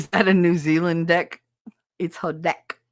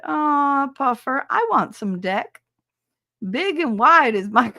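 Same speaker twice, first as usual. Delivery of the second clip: higher, hammed-up sexy chat read; playfully suggestive, higher voice; mock sultry read; 7 semitones higher, 4 dB louder, slower than usual. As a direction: read the message like a cheesy come-on. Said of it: "I gotta read this out loud".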